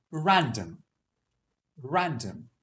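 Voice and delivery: "random" with a crisp British R; British accent, R pronounced at the start. Shown as a demonstration. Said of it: "in a British accent".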